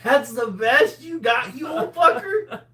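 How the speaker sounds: high, loud mocking crow; derisive jeer. Real, not acted. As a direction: jeer through laughter, voice shooting up. Mocking an opponent in banter.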